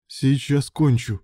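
plain reading voice

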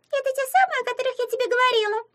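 very high, squeaky little imp voice; giggly mischief-maker. A narrator's reading. Imp showing Anya a gift, giggly squeak. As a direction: pipe it up fast and squeaky, pleased with yourself. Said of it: "хихикнула вреднючка".

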